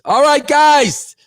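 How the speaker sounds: high, slow, bright call to chat; rally the viewers; upbeat sign-off. Real, not acted. Streamer wrapping up stream.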